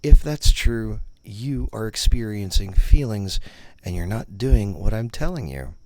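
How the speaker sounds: ordinary talk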